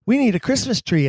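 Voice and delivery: jumps high, retelling a mock sudden panic; a light high "oh wait" of remembering; high-pitched imitated realization. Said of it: "I was like".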